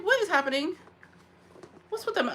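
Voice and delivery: high incredulous scolding; mock indignant. Retold scolding, pitch high.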